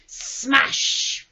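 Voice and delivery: loud, drawn-out hissed start, then bang; explosive smash. A narrator's reading. The jar breaks on the root, explosive loud smash. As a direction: stretch and burst the word like breakage.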